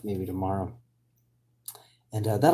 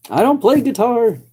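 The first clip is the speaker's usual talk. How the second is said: mock yokel denial; 13 semitones higher, 8 dB louder than usual. Jokey denial in put-on voice.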